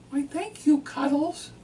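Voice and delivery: high, loud cooing wife voice; mimicking his wife. Husband voices his wife thanking him sweetly, high and loud. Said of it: "And she said".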